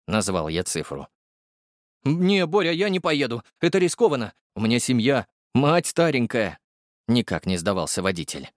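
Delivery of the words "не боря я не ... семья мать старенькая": high, gossipy driver voice; the driver Igor bringing Borya the news, high and chatty; chatty young driver. Acted. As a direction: rattle it off, pitched high. Igor refuses again.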